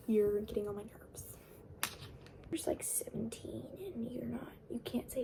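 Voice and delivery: hushed, fed-up muttering aside; exasperated whisper. Real, not acted. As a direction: whisper your annoyance at her. Ranting aside at the character.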